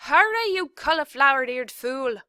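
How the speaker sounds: loud, high furious insult; shouted. A narrator's reading. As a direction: yell the insult at her. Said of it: "shouted Cook".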